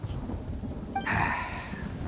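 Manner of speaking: a long, breathless sigh before confessing; heavy sigh. Acted. Oni sighing before explaining the truth to outlanders, heavy sigh. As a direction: let out a weary unvoiced sigh.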